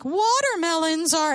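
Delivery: high, loud, slow goofy voice; silly voice. Retold or imitated. Dad disguising his voice for his daughter, high loud goofy voice. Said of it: "a silly voice".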